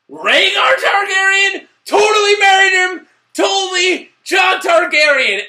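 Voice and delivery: high, loud excited shout. Real, not acted. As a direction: yell it like you just cracked the twist. Fan realizing a twist.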